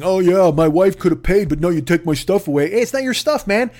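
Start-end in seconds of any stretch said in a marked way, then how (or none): 0.0-2.7 grousing. Retold or imitated. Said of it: "he's b-, grousing".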